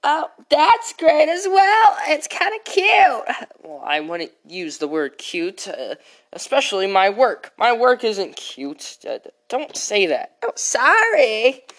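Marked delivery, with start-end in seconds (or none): 0.1-3.5 high, slow, sugary little voice; high sweet voice. Acted. High character voice. 10.4-11.6 high, slow, sheepish voice; sweet apology. Acted.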